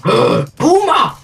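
gasp, then a high alarmed yell; panicking gamer. Real, not acted. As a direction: spot the monster and panic. Panic at a boomer.

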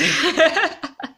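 a burst of high laughter; giggly high laugh; high bright laugh. Real, not acted. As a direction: laugh out loud, pitch up. Laugh alone on line.

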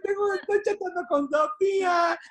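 mock ecstatic cheer, half laughing; giddy delight. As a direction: gush like lockdown is the best thing ever. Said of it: "Hay días que te levantas y dices".

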